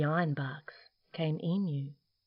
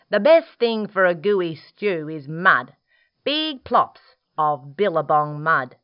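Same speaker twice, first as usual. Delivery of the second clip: platypus voice; 7 dB louder than usual. Acted.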